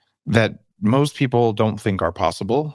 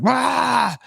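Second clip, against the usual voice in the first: high imitated toddler wail; mock child wail. Imitating the screaming kid in the waiting room, high wail. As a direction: squeal it like a kid running in circles. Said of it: "starts screaming".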